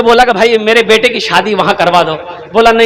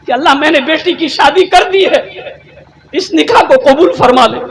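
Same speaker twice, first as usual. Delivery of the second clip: higher-pitched, imitated weeping plea; tearful prayer; 7 semitones higher than usual. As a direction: retell a man's tearful plea to God. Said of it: "रो रहा था". (रोते हुए दुआ की नक़ल)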